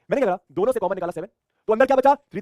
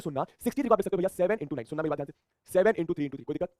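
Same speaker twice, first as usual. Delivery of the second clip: ordinary delivery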